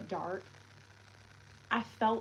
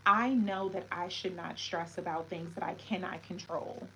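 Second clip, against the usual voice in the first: ordinary delivery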